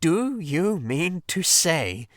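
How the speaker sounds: exasperated. Acted. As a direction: spell it out in disbelief. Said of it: "cried the exasperated Rat".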